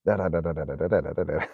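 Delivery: run-on yammering nonsense syllables; mock complaining. Mimicking father-in-law's endless demands in the car, mock complaining. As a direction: imitate his endless griping as babble. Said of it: "he just kept complaining and complaining".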